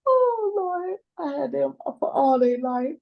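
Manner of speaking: high, breaking voice through sobs; crying. Real, not acted. Grandmother pleading to the judge, sobbing.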